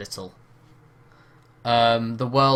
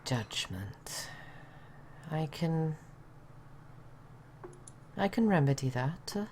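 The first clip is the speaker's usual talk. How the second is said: put-on Welsh accent; 5 dB quieter than usual. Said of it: "really bad Welsh accent".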